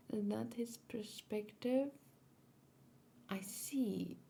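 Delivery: a slow, hushed wondering whisper. Real, not acted. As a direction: ask it softly to yourself. Whispered question while watching.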